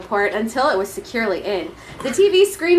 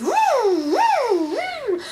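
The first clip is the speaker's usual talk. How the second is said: whooshing vent; 12 semitones higher, much slower than usual. Acted. Air vent noise.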